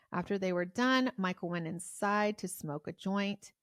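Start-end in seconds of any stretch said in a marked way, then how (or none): none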